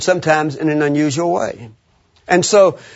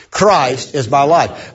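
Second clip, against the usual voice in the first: slow, measured emphatic declaration; spaced out for the crowd to repeat; 2 semitones lower, slower than usual. Shown as a demonstration. Preacher says it again for the group, emphatically. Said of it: "And emphasize it".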